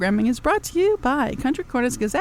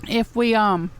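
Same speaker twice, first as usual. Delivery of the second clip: ordinary delivery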